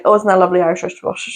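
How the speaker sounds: fast tongue twister, rattled off; said really quick. Shown as a demonstration. Friend rattling off the tongue twister quickly. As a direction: rush it out quick, Irish wristwatch. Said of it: "Say it really quick".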